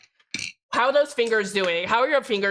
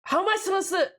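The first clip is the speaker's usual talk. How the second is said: real disbelief; 8 semitones higher than usual. Real, not acted. Keyboard falls apart.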